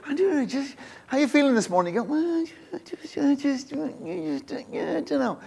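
groggy morning grumbling. Acted. Preacher acting out a bad morning, weak groggy grumbling. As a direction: act out feeling off first thing, words petering out. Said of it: "get up in the morning, you feel like that".

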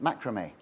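"Macrame" said MACK-ruh-may; low, soft "Macrame", stressed on MACK; first-syllable macrame. Shown as a demonstration. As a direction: settle on "Macrame" with a short first a.

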